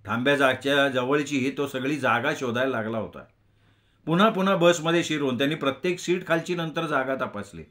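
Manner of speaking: ordinary delivery, nothing marked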